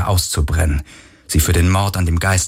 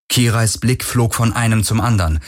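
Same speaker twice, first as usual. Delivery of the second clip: usual speaking voice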